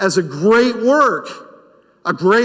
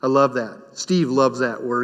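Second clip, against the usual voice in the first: ordinary talk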